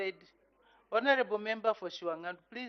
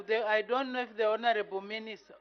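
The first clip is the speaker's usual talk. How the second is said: normal talking voice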